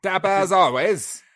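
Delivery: high, loud, hammed-up mock Cockney; bad Cockney accent. Co-host greeting the host, dapper as always, terrible Cockney accent. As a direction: botch a South Cockney Wales accent on purpose. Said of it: "English accent I have ever heard".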